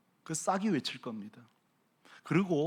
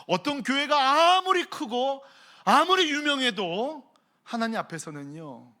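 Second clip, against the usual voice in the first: high, loud, slow warning; preaching shout; 6 semitones higher, 6 dB louder than usual. Real, not acted. Preacher warning churches, loud and high. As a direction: proclaim it hard.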